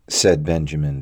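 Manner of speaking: usual speaking voice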